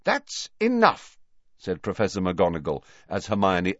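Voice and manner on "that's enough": slow, high, loud command; furious stop. A narrator's reading. McGonagall silencing the bickering students, furious. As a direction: cut the quarrel off hard. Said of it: "looking furious".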